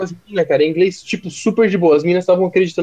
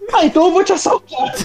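high thug voice; 12 semitones higher than usual. Retold or imitated. Stranger threatens robbery.

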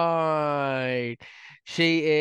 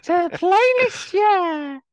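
high squeaky meowing cat voice; 7 dB louder, much slower than usual. Acted. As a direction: talk like a cat, stretched. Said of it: "that's [name]'s cat voice".

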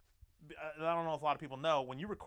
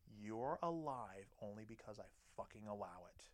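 low, soft, menacing threat; quiet seething fury; furious; 4 semitones lower, 9 dB quieter than usual. Retold or imitated. Husband threatening his ex-wife, low quiet fury. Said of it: "I was furiously pissed at her".

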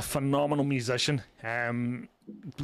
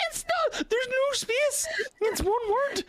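very high, squealing laughter through the words; laughing hard; slower than usual. Real, not acted. Correcting a friend's split-up name, laughing hard. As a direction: barely get it out for laughing.

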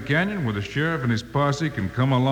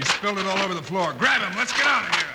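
urgent shouting. Acted. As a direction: yell to get out fast. Escape before the explosion.

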